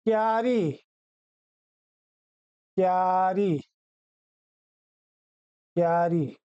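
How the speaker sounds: क्यारी with joined kyaa, two syllables; correct pronunciation. Shown as a demonstration. Teacher gives pupils the correct word, क्यारी said as kyaa-ri. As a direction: run k straight into yaa in क्यारी. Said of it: "इसका शुद्ध क्या रूप है".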